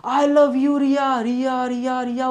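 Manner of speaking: imitating a fading mountain echo; mock echo. Teacher imitating a film echo in the hills, fading repeats. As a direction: let the name repeat and fade like an echo. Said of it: "अब वो पूरा आवाज़ गूंजने लगती है".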